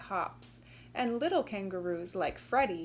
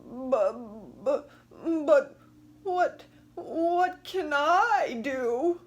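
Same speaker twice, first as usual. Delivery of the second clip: high, loud, sobbing stammer, slow; wailing crocodile, stuttering and weeping; crying crocodile; 5 semitones higher, 8 dB louder, slower than usual. Acted. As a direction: blubber out the words through tears. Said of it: "The crocodile began to cry too".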